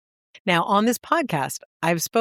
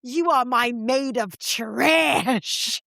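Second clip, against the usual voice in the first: mock indignant bride; 5 semitones higher, slower than usual. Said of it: "And Tina says".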